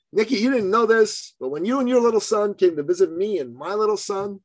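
high-pitched imitation of a tearful woman; tearful retelling. Grateful client tells her coach through tears, mildly tearful. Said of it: "between sobs and tears and sniffles".

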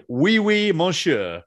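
high, loud mock French accent. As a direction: put on a playful French accent. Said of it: "in a French accent".

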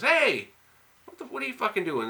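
sharp high shout at a stranger; yelling at a thief. Retold or imitated. She yells at car thief.

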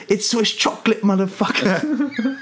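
high-pitched character voice, drawn out on the swear; trailer character quote. Retold or imitated. Host quoting the trailer's chocolate swindle, acted character voice. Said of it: "And he goes".

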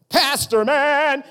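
loud mock-heroic exclamation; mock hype. Pastor mocking the idea that the title gives powers, mock hype. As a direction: belt it out like a superhero's title.

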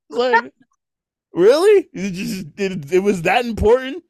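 high incredulous really; sarcastic disbelief; mock-amazed. Mocking the boss's urgency.

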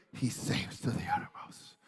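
sudden near-whisper; whispered preacher's refrain; hushed refrain. Real, not acted. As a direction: drop to a soft, breath-led hush. Hushed refrain after loud preaching.

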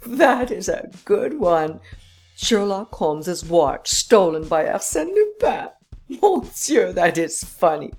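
high, laughing through the words; can barely talk for laughing. A narrator's reading. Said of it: "He roared with laughter".

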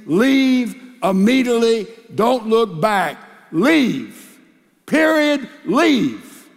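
loud, insistent repeated order to leave; forceful command. Real, not acted. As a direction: hammer each word home like a final ruling. Urging abuse victims to leave.

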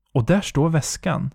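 usual speaking voice

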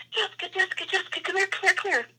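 high, hushed rapid-fire summons; high, repeated hushed call; retold urgent calling. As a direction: imitate the friend urgently calling without raising the voice. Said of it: "and he called me".

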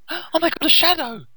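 mock terror. Reviewer hams up the frightened girl's shriek, mock terrified. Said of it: "She's terrified".

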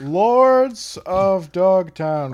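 very slow, drawn-out title; slow dramatic title read. Acted. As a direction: stretch the title out like a movie trailer.

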